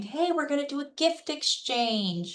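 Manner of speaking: high, put-on chirpy invitation; group organizer act. Scammer pitching a Facebook group gift exchange, put-on friendly. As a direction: pitch it up like a chummy group post. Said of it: "added into a Facebook group saying".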